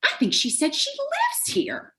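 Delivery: high, slow, incredulous Southern tourist. Acted. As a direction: marvel in disbelief to a friend. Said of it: "I heard one of them say incredulously".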